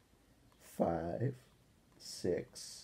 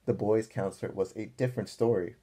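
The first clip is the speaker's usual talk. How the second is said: ordinary delivery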